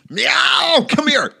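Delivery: high, loud meow as a name; mock parent yelling for the kid; calling out. Pretends calling a child named Meow.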